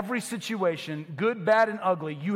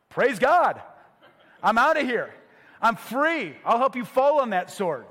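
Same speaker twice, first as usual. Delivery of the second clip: louder, higher whoop of a freed prisoner; gleeful prisoner; 5 semitones higher, 5 dB louder than usual. Pretend. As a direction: laugh and cheer your escape.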